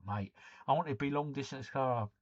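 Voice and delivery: ordinary talk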